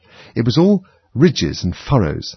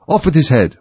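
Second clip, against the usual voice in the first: high, raised furious order; shouting; 6 semitones higher, 4 dB louder than usual. A narrator's reading. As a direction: shout it in a passion. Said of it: "and shouting".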